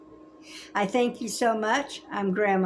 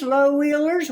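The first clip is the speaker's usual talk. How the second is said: ordinary delivery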